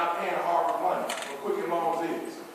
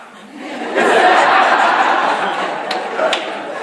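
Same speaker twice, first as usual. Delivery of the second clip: long loud laugh; 11 dB louder than usual. Real, not acted. Laughing at the transition joke.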